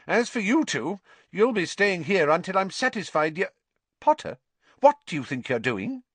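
sharp, rising to a high snap on Potter; a scolding matron catching him; stern nurse. A narrator's reading. Nurse scolding patient out of bed.

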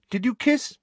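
brisk, high-pitched question. A narrator's reading. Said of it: "asked Hermione briskly".

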